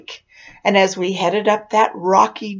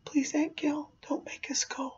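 tiny, high whispery begging; imitated tearful pleading; 7 semitones higher, 14 dB quieter than usual. Jason begging Aunt Gail not to go, tearful. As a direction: beg very softly and high, near tears. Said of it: "I can see the tears in his eyes".

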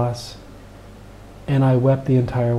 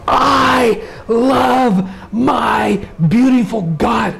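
ecstatic; 10 semitones higher, 3 dB louder, much slower than usual. Retold or imitated. First sight of Baba at darshan.

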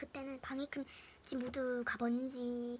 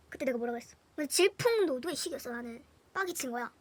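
ordinary delivery

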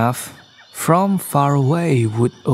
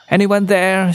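calling out; 6 semitones higher, 3 dB louder than usual. A narrator's reading. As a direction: call into the distance for anyone. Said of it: "she called out".

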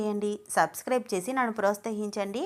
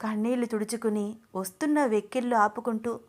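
ordinary delivery, nothing marked

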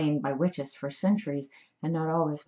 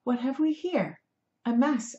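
put-on high, lilting girl's voice with an accent; musical voice; 7 semitones higher than usual. A narrator's reading. Kind rescuer finding an injured cat, musical voice. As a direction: lilt it, curious and kindly. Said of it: "her voice and accent music to me".

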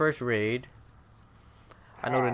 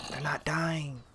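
higher-pitched, voiced complaint; voiced worry; 4 semitones higher, 3 dB quieter, slower than usual. Real, not acted. Repeats the whispered worry aloud.

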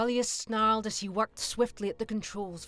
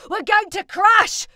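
high, loud panicked shout; 13 semitones higher, 16 dB louder than usual. Acted. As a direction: yell it as you plunge. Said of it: "shouted the pilot".

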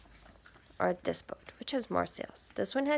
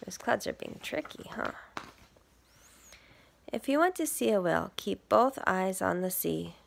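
plain reading voice

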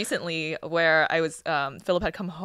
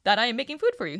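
indignant; 7 semitones higher, 4 dB louder than usual. Retold or imitated. Retold indignant reply, high loud.

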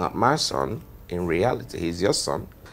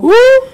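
very high, loud whoop; mocking whoop; 14 dB louder than usual. Husband rejects his wife's plan, loud high whoop. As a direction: burst out with a piercing "woo".

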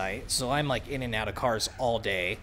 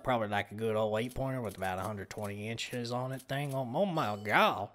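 put-on southern accent. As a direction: ham up a country Southern accent. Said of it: "See, I'm decent at it".

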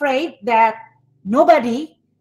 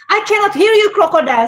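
teacher calls out louder and higher to the class; 9 semitones higher, 5 dB louder, faster than usual. Shown as a demonstration. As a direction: coax a shy class into answering, loud and high. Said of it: "So when I change my, my voice tone".

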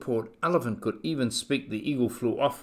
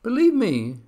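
earnest plea; 10 semitones higher, 7 dB louder than usual. A narrator's reading. Horton pleads with the kangaroos.

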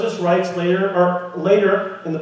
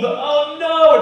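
high, loud "oh no"; mock dismay; 9 semitones higher, 4 dB louder than usual. Preacher springing the complication, high and loud. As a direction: land the twist with drama. Exclaiming the twist.

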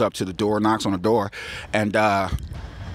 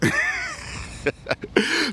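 quiet wheezy chuckle; real laugh; 4 dB quieter than usual. Real, not acted. Storyteller tickled by Connor's comeback, laughing. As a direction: laugh at your own punchline. Teller laughs at own line.

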